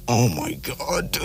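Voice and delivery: slow, near-whispered dismay; mortified hush. Retold or imitated. Embarrassed boyfriend as everyone stares, mortified hush. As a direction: say it under your breath, drawn out.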